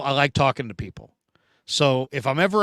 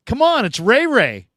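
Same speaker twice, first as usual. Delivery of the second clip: higher, pleading for a fan's excitement; mock-defensive, come on guys; 7 semitones higher, 3 dB louder than usual. Retelling his reply to the press box, a higher, excited defense. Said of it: "I'm like".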